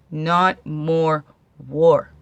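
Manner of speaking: "war" punched loud at the end; loud final "war"; stress on war. Real, not acted. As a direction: land hard on the word "war". Peace not war contrast.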